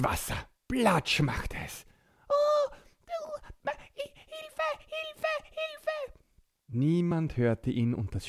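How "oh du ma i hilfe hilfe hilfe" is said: very high frantic calls for help; panicked cry for help. Acted. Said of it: "ganz verzweifelt".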